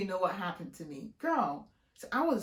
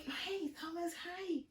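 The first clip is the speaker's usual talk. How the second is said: fake-friendly high greeting; 8 semitones higher, 4 dB quieter than usual. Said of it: "So I'm like".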